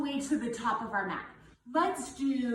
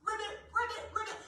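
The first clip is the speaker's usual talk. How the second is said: frog ribbit; 9 semitones higher, 4 dB quieter, much faster than usual. Shown as a demonstration. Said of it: "Say it loud for me".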